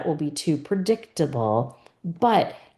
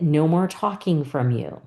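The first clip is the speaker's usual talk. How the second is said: normal talking voice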